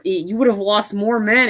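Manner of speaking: usual speaking voice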